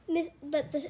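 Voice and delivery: ordinary delivery, nothing marked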